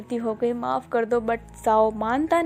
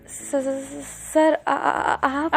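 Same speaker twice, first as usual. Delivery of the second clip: stuttering in fear, slow and broken; caught-out boy stammers nervously at the boss; 3 dB quieter, much slower than usual. A narrator's reading. Caught smoking, the boy stammers to his boss. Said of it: "बहुत ही ज्यादा nervous हो जाता है".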